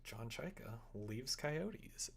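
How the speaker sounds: normal talking voice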